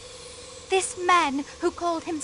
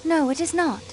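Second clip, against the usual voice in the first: ordinary delivery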